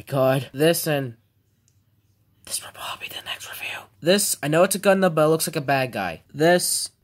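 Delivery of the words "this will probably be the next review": fast unvoiced whisper; excited whisper. Real, not acted. Teen whispers excitedly about next toy, whispering. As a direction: hush it like a secret.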